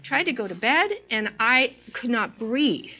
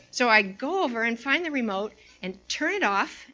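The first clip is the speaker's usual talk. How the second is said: ordinary talk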